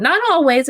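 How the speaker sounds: "Not always" with "Not" hit high and loud; stress on not. Real, not acted. Teacher corrects herself, stressing "Not". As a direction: punch "Not" to correct yourself. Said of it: "not always condescending".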